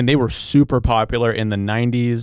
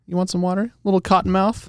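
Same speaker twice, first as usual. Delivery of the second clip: teasing; 9 semitones higher than usual. Real, not acted. Teasing co-host about dry mouth.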